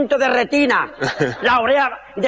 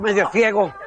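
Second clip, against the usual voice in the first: normal talking voice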